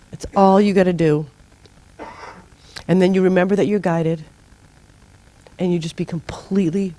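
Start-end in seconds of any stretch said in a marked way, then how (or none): none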